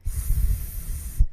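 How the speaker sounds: isolated s hiss, voice off; s sound. Shown as a demonstration. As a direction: hiss s at the tip, then shift back. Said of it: "the sh sound is simply pushing that sound backwards".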